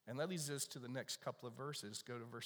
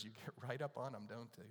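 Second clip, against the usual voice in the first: usual speaking voice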